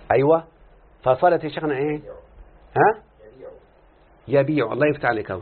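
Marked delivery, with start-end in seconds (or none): none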